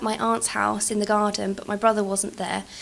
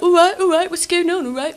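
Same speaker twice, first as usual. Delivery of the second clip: loud, high cocky greeting; swaggering brother; 8 semitones higher, 6 dB louder than usual. Retold or imitated. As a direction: strut in shouting hello.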